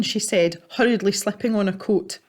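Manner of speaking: usual speaking voice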